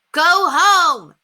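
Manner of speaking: put-on loud, high boy's shout; yell. A narrator's reading. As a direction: shout them away. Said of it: "Murph yelled".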